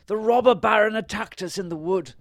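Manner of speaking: high, loud wizard voice; story wizard. A narrator's reading. Wizard tells the king of the attack, high loud voice. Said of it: "said the wizard".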